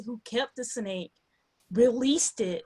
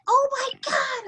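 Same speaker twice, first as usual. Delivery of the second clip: mock awed kid voice; slower than usual. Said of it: "And he's like".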